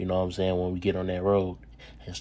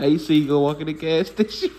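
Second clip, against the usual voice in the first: laughing out the question, louder and higher; 6 semitones higher, 5 dB louder than usual. Real, not acted. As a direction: ask it while cracking up. Cracking up at friend's line.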